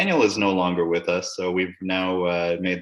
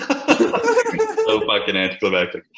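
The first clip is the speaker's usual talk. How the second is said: laughing it out, louder; 4 semitones higher, 4 dB louder, slower than usual. Real, not acted. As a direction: laugh through the words in disbelief. Laughing at friend dropping off call.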